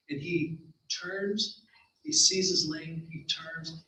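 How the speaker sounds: slow dramatic narration. A narrator's reading. Slowed narration before flight.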